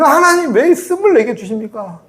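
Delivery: high-pitched mock outcry to God; acted angry protest. Believer blaming God for bitter water, acted angry protest. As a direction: protest upward, as if wronged. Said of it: "내게 왜 쓴물을 줬냐고 화를 내고".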